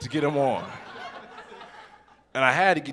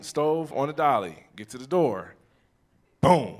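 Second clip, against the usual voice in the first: ordinary talk, nothing marked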